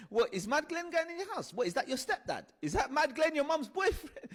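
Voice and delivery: put-on high, teasing kids' voices; mocking kids. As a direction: taunt him like playground kids.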